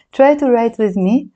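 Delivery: ordinary talk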